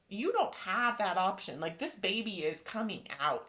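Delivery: ordinary talk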